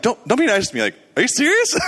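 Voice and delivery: high-pitched, rising disbelief; incredulous. Retold or imitated. Praying visitor doubts the woman can see, incredulous. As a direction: retell it as a stunned, pleading check.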